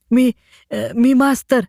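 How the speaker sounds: put-on a frightened village boy stammering out his name. A narrator's reading. A scared boy answers the ticket collector, stammering. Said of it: "विश्वास तात्रत म्हणाला".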